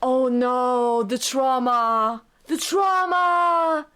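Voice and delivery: very slow, anguished lament; drawn-out wail. Real, not acted. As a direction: wail it out, stretching every word. Repeats exclamation as slow wail.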